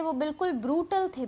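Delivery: usual speaking voice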